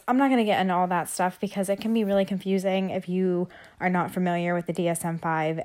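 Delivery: ordinary talk, nothing marked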